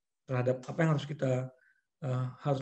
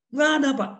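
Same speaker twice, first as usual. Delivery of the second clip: high, loud defensive reply; a worker insisting to the boss; staff denying; 10 semitones higher, 7 dB louder than usual. Retold or imitated. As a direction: pool staff answering back. Staff answers angry boss.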